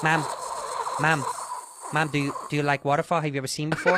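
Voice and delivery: soft, halting son voice; lightly voiced broken-English questioning; Bobby Lee. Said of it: "I'm Bobby Lee".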